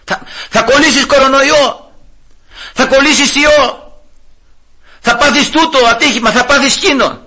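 high mock warnings of doom; lightly voiced tempter listing threats; Satan's scare voice. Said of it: "έρχεται ο σατανάς ... να μας φοβήσει".

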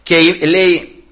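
ordinary talk, nothing marked

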